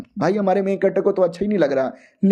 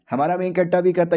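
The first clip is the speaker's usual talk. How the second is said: normal talking voice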